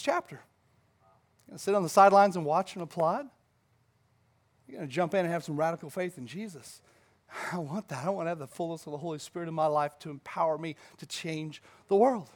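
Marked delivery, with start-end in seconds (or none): 5.2-12.3 hushed earnest declaration. Real, not acted. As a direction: drop to a quiet, heartfelt longing.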